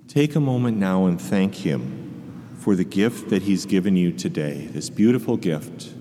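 calm them, leave long pauses. Acted. Continues the guided meditation.

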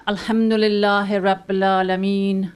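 ordinary talk

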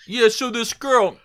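big, showy comedian bit; Andrew Dice Clay. Acted. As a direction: belt it out like a stand-up telling a dirty story. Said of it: "like, Andrew Dice Clay".

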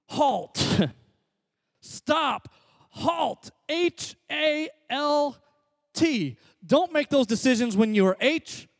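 loud, high, driving preacher voice; full-force preaching. Acted. Pastor drilling HALT into the congregation, full-force preaching. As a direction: preach it hard and build to each point.

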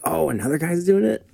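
ordinary talk

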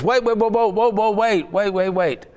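a salesman panicking as his customer drives away; frantic wait. Retold or imitated. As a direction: stop him from driving off, in a rush. Salesman stops leaving customer.

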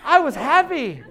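loud, high, laughing whine; hammed-up wounded complaint; mock accusation. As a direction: whine it half-laughing at the mood thief. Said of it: "and it was like".

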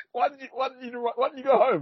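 high, squeaky voice through laughter; cracking up while asking in disbelief; laughing incredulously. Real, not acted. Podcaster mocking why he didn't go home, laughing incredulously. Laughing at character's baffling choice.

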